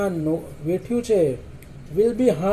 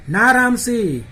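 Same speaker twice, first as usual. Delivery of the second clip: raised, louder narrated cry of alarm; mild fearful shout; 5 semitones higher, 5 dB louder, slower than usual. A narrator's reading. Prem Chopra cries out to Ramsi in fear, mild shout. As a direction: call out higher and louder, mildly afraid. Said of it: "પ્રેમ ચોપરાએ ભયથી બૂમ પાડતા".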